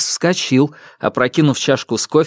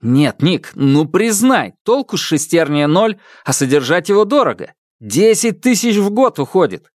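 higher, pressing argument; stubborn insisting; 7 semitones higher than usual. Acted. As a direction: keep pushing your point. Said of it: "продолжил гнуть своё".

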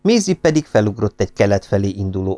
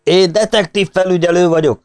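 high, loud official shout; 8 semitones higher, 3 dB louder than usual. A narrator's reading. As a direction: announce your rank over the mob. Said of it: "kiáltotta Drive".

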